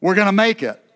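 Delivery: call to repeat. Shown as a demonstration. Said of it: "I want you to say this with me tonight".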